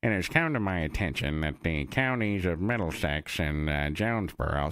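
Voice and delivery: low cartoon cop voice; Wiggum. Shown as a demonstration. State trooper making an announcement on the radio, cartoon cop voice. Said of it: "I just did Wiggum's voice".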